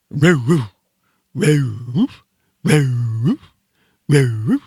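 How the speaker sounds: southern-accented dog bark, drawn out with a rising end; North Carolina retriever bark. Shown as a demonstration. Host imitating a North Carolina golden retriever, southern dog bark. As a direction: bark with a Carolina drawl, swooping up. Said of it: "golden retrievers from North Carolina bark like this".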